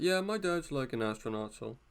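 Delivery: a schoolkid inventing a famous dad; bragging kid voice. Retold or imitated. Kid boasting to classmates, smug lying brag. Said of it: "How do I do that accent so well?".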